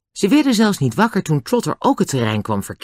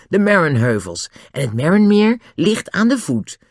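lively excited pointing-out; elated; 7 semitones higher, 3 dB louder than usual. A narrator's reading. Julian shows the others the hills and lake, elated. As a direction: point out the view with delight. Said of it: "zei Julian uitgelaten".

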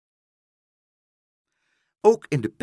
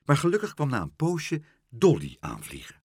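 usual speaking voice

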